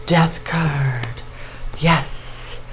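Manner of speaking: whispered, unvoiced reveal; hushed whisper. Real, not acted. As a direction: whisper it like a secret.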